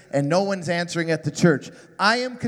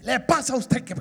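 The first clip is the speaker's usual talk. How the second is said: put-on a Cuban-accented Spanish scolding, higher; Ricky Ricardo Spanish; 5 semitones higher than usual. The dad scolding his son in Cuban-accented Spanish. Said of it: "full blown Ricky Ricardo".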